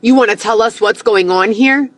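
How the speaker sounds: ordinary talk, nothing marked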